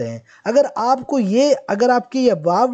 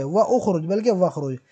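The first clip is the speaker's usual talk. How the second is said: normal talking voice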